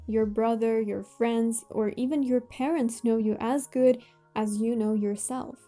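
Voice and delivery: ordinary delivery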